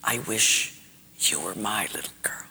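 hushed, low, fading whisper. Acted. Teacher repeating her words to crying pupil, hushed whisper. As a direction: begin softly, sink into a whisper. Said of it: "So she repeated it again".

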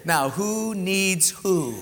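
mock God voice. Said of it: "I heard God say".

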